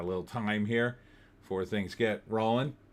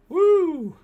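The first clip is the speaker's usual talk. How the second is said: woo; 9 dB louder than usual. Real, not acted. Whooping after a long week.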